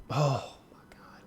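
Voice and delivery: whispered, drawn-out gasp of awe; awed whisper. Real, not acted. Fan recalling a beloved TV scene, awed whisper. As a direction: breathe it slowly, overcome.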